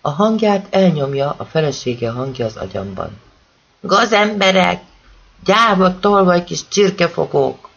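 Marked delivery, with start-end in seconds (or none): 3.8-7.7 higher, louder, slow angry cry of a woman; a wife shouting at the boys who broke in; furious wife. A narrator's reading. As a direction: hurl the insults at the thieving boys. Wife's remembered furious outburst.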